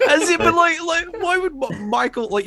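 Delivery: high squeaky laughing disbelief. Real, not acted. Mocking Keaton post, laughing.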